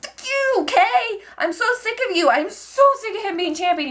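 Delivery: high-pitched, exasperated fan rant; annoyed outburst. Real, not acted. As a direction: shriek it like a fed-up fan. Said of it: "I'm getting so annoyed".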